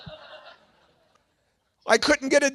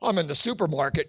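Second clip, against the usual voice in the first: ordinary talk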